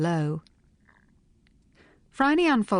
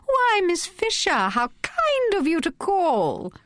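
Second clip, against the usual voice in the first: very high, slow, gushing Irish-free society voice; shrill society hostess; 13 semitones higher, slower than usual. A narrator's reading. Melanie Cryer gushing at Phryne on the phone, very high and slow. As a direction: squeal it out like an affected rich hostess. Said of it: "A shrill voice exclaimed".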